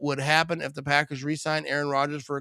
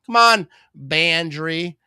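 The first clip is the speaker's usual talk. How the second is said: louder come on; 6 dB louder, much slower than usual. Real, not acted. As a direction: call out to the team with a raised voice. Urging the team on, louder.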